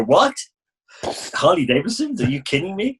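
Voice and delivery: high, disbelieving mock surprise; mock disbelief, pitched well up; incredulous people. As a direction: play the amazed stranger hearing it's a Harley. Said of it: "And people would go".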